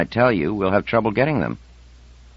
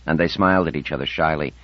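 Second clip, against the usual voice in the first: usual speaking voice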